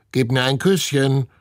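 high parrot squawk; croaking. A narrator's reading. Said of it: "krächzt Herr Klodwig". (krächzender Papagei)

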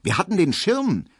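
high-pitched surprised query; astonished woman's high voice. A narrator's reading. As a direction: ask it in amazement, voice lifted high. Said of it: "fragt Frau Braunack erstaunt".

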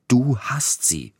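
high, slow, insistent accusation; stubborn accusation. A narrator's reading. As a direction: insist stubbornly, stretching the words. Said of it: "behauptete er hartnäckig". (hartnäckig beschuldigend, hoch und gedehnt)

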